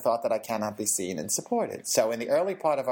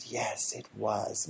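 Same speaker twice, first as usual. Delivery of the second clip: small reply; 4 semitones lower, 6 dB quieter, much slower than usual. Retold or imitated. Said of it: "And the girl said".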